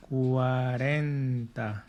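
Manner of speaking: very slow cuarenta, every syllable drawn out; slow model word. Shown as a demonstration. Teacher drills cuarenta for students, very slowly. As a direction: stretch cuarenta out for learners to copy. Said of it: "Say it with me".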